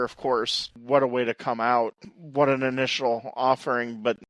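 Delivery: normal talking voice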